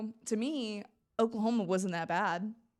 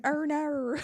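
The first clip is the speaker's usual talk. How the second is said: louder, higher mock-Australian; Australian accent; 7 semitones higher, 6 dB louder than usual. Contestant showing how the accent slips out, mock Australian. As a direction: exaggerate the Australian vowels for a laugh. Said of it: "I have a sympathetic accent".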